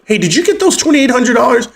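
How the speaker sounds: high put-on voice of the tax lady, chirpy question; imitating the tax lady. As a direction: mimic her cheery high question. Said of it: "My tax lady literally goes".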